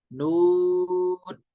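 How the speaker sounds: "नून" with a very long oo; three-alif elongation. Shown as a demonstration. Teacher demonstrating the madd to students, drawn out. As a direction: hold the oo of "नून" on a level note. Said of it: "तीन अलिफ खींचेंगे".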